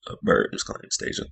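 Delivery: a hushed little aside; whispered to herself. Real, not acted. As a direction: whisper it as a side note. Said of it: "I'm gonna call her Anastasia".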